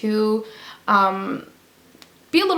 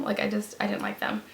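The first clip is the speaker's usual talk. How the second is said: whispered aside; 9 dB quieter than usual. Real, not acted. Quiet verdict on disliked liners.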